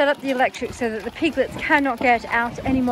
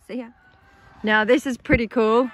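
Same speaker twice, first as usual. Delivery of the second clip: ordinary talk, nothing marked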